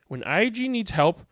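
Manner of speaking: usual speaking voice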